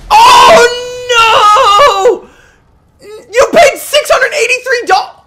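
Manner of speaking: real high-pitched yelling outrage; outraged shout. Real, not acted. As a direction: scream it in disbelief. Outraged at game fine.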